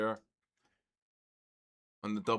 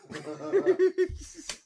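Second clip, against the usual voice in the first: high delighted laugh; 7 dB louder than usual. Real, not acted. Owner laughing at his dog's growl, high delighted laugh.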